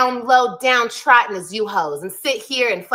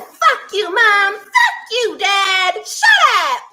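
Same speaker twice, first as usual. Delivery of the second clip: high shouted mock tantrum; hammed-up high yell at mom and dad; mock cussing out parents; 9 semitones higher, 3 dB louder, much slower than usual. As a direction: yell it like a bratty kid screaming at her parents. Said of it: "Have I ever came on here and said".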